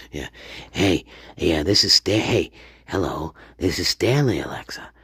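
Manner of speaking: low put-on gadget voice; low comic device persona; mock Stanley Alexa. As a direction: drop low for a joke assistant, sinking at the end.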